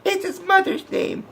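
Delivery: very high put-on squeal; Lois. Reviewer imitates Lois interrupting the fight, squeaky mock cry. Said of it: "Lois fucking pops out of nowhere and says".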